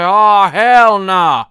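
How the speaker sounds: slow, drawn-out loud "hell nah"; loud refusal. Retold or imitated. Rei refusing Gendo with a slow loud outraged cry.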